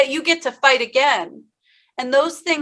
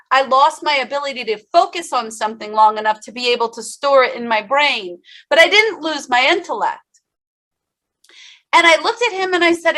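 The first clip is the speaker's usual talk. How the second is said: usual speaking voice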